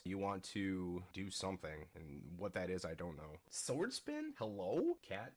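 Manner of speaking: usual speaking voice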